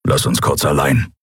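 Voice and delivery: low growled order; Keru. A narrator's reading. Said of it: "knurrte er".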